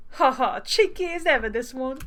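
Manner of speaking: cheeky, badly faked British accent. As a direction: tease in a hammed-up British accent. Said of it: "he's gonna have a British accent".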